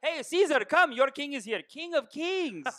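put-on high Persian accent, laughing. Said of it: "I do Persian one all day".

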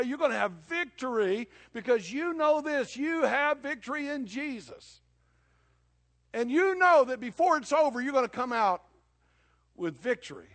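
loud, high, rising proclamation; preaching at full force. Acted. Preacher promising victory, loud triumphant preaching.

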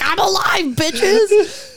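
high, hammed-up triumphant cry; triumphant mock shout. Voicing Harry coming back to life, mock triumph. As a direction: crow it in a high, cheeky voice.

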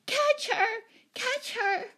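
put-on very high child's scream. A narrator's reading. Small Betsy chasing the escaped cat, screaming. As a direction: shriek it like a frantic little girl. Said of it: "screaming at the top of her voice".

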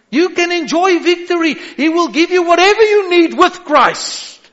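high, loud preacher's cry; preaching at full force. Acted. Pastor assuring the congregation, in full preaching cry. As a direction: lift the church with a ringing call.

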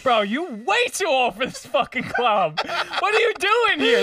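high, laughing mock bouncer voice; hammed-up bouncer, pitched high and laughing. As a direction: imitate the bouncer turning him away with a high, laughing put-down. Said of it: "He's like".

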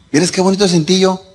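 ordinary delivery

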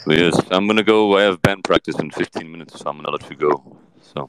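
Johnny Depp. Acted. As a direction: answer low with thoughtful pauses. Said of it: "Johnny Depp is on the show".

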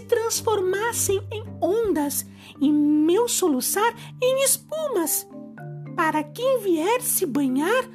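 put-on gentle high fairy-tale girl; mermaid voice. Mermaid makes her wish. (voz doce de sereia)

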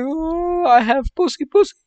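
high, loud wailing woman's voice, hammed-up; mock crying woman. A tourist overhears a woman crying about her diagnosis, mock wail. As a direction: wail it out as a sobbing woman, played for laughs. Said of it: "you hear some woman crying".

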